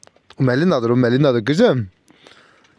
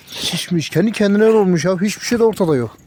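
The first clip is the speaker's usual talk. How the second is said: ordinary talk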